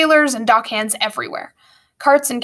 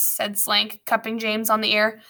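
usual speaking voice

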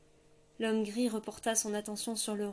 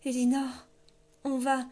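urgent call; 3 semitones higher, 6 dB louder than usual. A narrator's reading. Silver calling as swept away.

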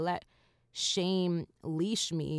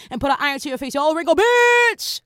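loud, high rap-verse shout; 9 semitones higher, 11 dB louder than usual. Retold or imitated. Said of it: "she ended off her verse by saying".

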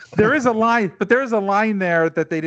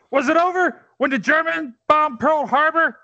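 high, shouted rhetorical question; shouted movie line; 9 semitones higher than usual. Acted. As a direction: roar the question out. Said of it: "famous speech".

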